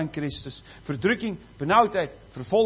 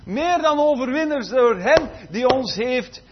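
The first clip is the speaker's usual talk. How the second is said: forceful preaching; 3 semitones higher, 5 dB louder than usual. Acted. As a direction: thunder the verse at the congregation. Preaching scripture reading.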